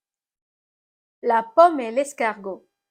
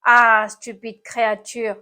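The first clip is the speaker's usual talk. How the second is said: groaning complaint; 6 dB louder than usual. A narrator's reading. Snail scolds the apple that hit it, groaning. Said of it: "gémit l'animal cornu".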